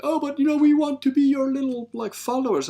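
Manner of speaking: high, loud fawning follower voice; mocking fawning follower. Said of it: "And it's like".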